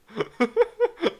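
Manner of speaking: high loud burst of laughter. Real, not acted. Player cracking up at own character voice, loud high laugh. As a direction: crack up at the silly voice.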